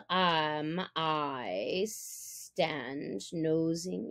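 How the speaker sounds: a lurking, threatening tiger; menacing tiger. A narrator's reading. Shere Khan demands the cub at the cave, low and slow.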